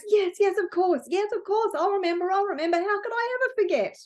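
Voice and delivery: high, breathless eager promise; little light promises to remember, high and eager; eager promising. Acted. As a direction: swear it with wide-eyed eagerness. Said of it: "is so excited".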